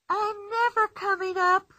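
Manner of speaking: put-on playful girl boasting from inside the tree; Pippi's voice. A narrator's reading.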